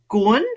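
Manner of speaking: GUN sliding sharply upward in pitch; upward intonation. Shown as a demonstration. Guest showing hosts the Vietnamese accent, upward intonation. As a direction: say GUN with a rising tone. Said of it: "upward intonation on the accent".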